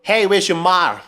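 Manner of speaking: Boston drawl on "ma", r dropped; mock Boston guy asking where your ma is; Boston accent. Shown as a demonstration. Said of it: "Paano ba yung accent ng mga Boston?".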